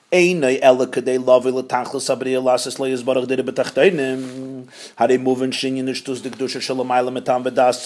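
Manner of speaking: low monotone recitation; reading text aloud. Acted. Teacher reading the next passage to students, low monotone. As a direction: read it on one low, steady note.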